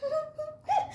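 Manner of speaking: put-on very high soft child's giggle; tiny child giggle. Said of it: "Contoh suara kecil".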